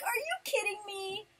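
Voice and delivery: high-pitched exasperated rant; loud, indignant complaint at a shoddy prize; mad. Retold or imitated. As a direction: reenact outraged disbelief, voice shooting up. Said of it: "I was so mad".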